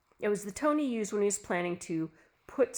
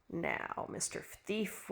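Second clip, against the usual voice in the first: taunting whisper; 9 dB quieter, much slower than usual. Acted. Taunting imagined thief.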